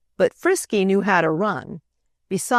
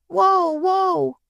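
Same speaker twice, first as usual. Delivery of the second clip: yelling whoa; 12 semitones higher than usual. A narrator's reading. Boy dragged by the calf, yelling high. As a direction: shout it while being dragged. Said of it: "yelled the little boy".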